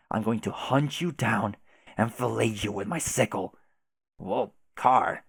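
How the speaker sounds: normal talking voice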